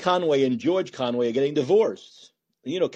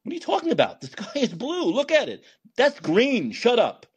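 high, exasperated disbelief; exasperated arguing; 7 semitones higher than usual. Retold or imitated. Pointing at the sky, exasperated at a denier who won't see. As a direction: cry out in disbelief at their denial. Acted argument about sky color.

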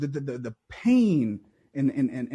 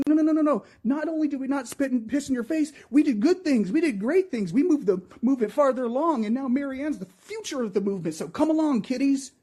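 mocking politicians; 7 semitones higher than usual. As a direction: sneer it like a smug party boss talking down.

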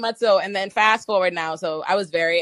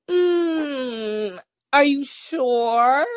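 mock; 5 semitones higher, much slower than usual. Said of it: "She's like".

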